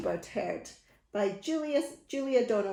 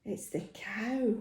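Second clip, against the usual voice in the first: plain reading voice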